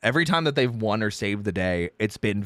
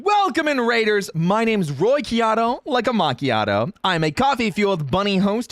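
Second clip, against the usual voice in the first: stream host voice; 9 semitones higher than usual. Acted. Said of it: "specializes in voice acting".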